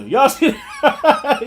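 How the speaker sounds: slow, laughing through the question. Real, not acted. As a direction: chuckle as you ask. Laughs while asking again.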